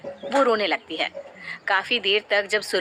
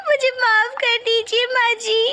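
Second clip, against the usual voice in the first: high, louder tearful plea for forgiveness; crying apology; 11 semitones higher, 3 dB louder than usual. Acted. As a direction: beg forgiveness through sobs. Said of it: "तू क्यों रो रही है". (रोते हुए माफ़ी माँगती बहू)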